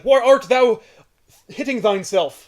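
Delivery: lightly hammed-up Shakespearean Thor; a touch of mock-grand old English; Shakespearean jackass. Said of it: "Thor talked like a Shakespearean jackass".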